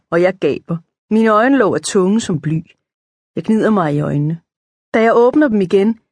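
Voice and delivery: plain reading voice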